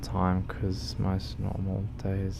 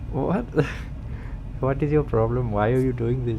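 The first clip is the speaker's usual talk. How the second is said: louder, hammed-up indignation at chat; mock outrage; 5 semitones higher, 4 dB louder than usual. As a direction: pretend offense, laughing underneath.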